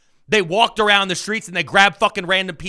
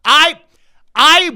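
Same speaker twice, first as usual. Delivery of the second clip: loud, higher bursts; revved up; 10 semitones higher, 10 dB louder than usual. Real, not acted. Host working himself up on air, loud revved shouts. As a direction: let the anger boil over. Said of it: "I'm getting revved now".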